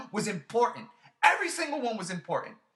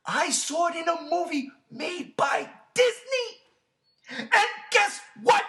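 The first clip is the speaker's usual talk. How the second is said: slow, rising, higher and louder reveal; building excitement; 7 semitones higher, 4 dB louder, slower than usual. Real, not acted. Teasing his co-host toward the reveal, rising excitement. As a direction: build up to the punchline.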